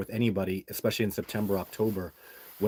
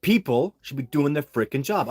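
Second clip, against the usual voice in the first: heated, raised venting; real exasperated venting about the agency; 4 semitones higher, 5 dB louder than usual. Real, not acted. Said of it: "I'm just venting a little bit".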